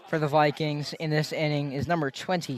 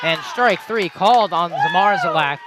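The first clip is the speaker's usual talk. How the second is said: louder, punched play-by-play call; excited strikeout call; 2 semitones higher, 6 dB louder than usual. Real, not acted. Announcer calls strike three to listeners, excited. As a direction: call the strikeout with a surge of energy.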